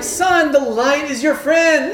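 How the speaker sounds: loud, high, slow sing-song shout; dad cheerfully teasing his sleepy son; cheery dad. Retold or imitated. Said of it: "And I'm like".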